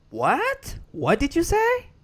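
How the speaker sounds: high, baffled "what?"; confused American guy. Retold or imitated. American guy can't understand the Burmese speakers, high baffled question. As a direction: play the puzzled American who can't follow.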